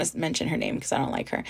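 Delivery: a quick whispered dig, barely voiced; whispered aside. Real, not acted. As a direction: drop to a secret whisper about someone you dislike. Aside about a disliked person.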